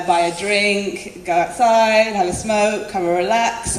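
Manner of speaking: ordinary talk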